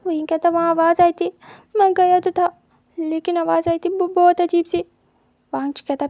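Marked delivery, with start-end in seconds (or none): 0.5-4.8 loud, high, frightened telling; scared. Acted. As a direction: blurt out what spooked him. Scared student describes strange noise. (डरते हुए ऊँची आवाज़ में)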